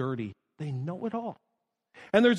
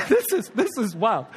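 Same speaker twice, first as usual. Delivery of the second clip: laughing. Real, not acted. Laughing at ugly feet.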